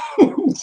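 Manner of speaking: sudden high laugh; burst of laughter. Real, not acted. Friend says covid vacation, he bursts out laughing. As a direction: crack up at the friend's joke.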